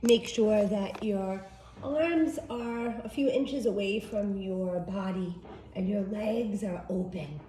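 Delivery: soft, unhurried meditation guide voice; guided meditation. Acted.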